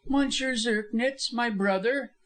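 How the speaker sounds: high-voiced widow standing firm; defiant answer. A narrator's reading.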